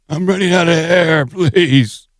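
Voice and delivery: breathless pleading, laughing and high; running out of air. Retold or imitated. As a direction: gasp out the plea, half laughing. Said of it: "scam plea acted".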